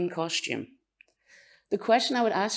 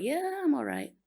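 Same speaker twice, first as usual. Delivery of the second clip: self-effacing; 9 semitones higher, 4 dB quieter than usual. Acted. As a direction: play down the compliment with a long, high 'yeah'. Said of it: "We're self-effacing".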